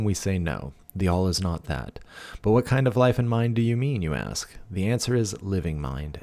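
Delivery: ordinary delivery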